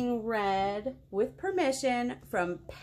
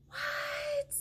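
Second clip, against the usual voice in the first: surprised; 6 dB quieter than usual. Acted. Reader shocked by the book.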